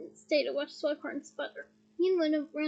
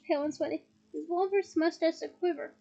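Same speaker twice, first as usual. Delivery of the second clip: ordinary delivery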